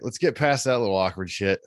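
ordinary talk

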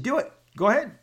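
high, loud defiant dare. Retold or imitated. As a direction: toss it back, daring her. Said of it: "I said".

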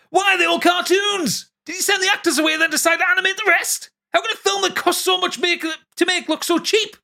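high, exasperated rant; shouting in capitals. Acted. Reading out a listener's review of The Flash, shouted in capitals. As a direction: yell each question as if typed in all caps. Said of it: "He has the capitals".

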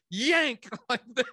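imitating the nurse's sudden pull; mock yank cry. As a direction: yelp it as she yanks. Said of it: "grabs my shoulders and goes".

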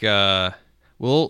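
ordinary delivery

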